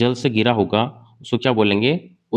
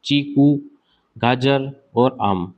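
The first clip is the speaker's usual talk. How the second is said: slowly spelling out a memory trick; drawn-out classroom mnemonic; much slower than usual. Shown as a demonstration. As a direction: lay each word down slowly for students to memorise. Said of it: "आप एक छोटी trick है".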